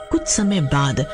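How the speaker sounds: ordinary delivery, nothing marked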